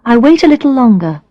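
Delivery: ordinary delivery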